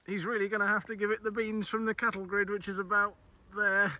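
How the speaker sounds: excited motorsport commentary, pitch rising; race commentator. Pretend. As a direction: call the hill climb like a race. Mock race commentary on himself.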